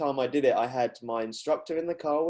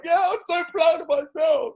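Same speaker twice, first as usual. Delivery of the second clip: hammed-up proud shout, high and loud; mock butch; 7 dB louder than usual. Mocking herself to her friend, shouting proudly. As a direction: play it big and tough. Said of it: "being really butch".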